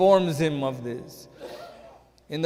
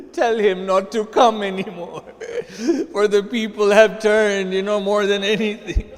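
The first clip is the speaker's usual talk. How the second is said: higher, louder, cracking with grief; sermon climax, choked lament; voice breaking; 4 semitones higher, 5 dB louder than usual. Acted. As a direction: let the voice break on the message.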